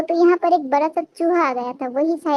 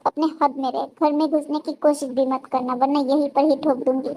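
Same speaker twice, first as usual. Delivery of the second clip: ordinary delivery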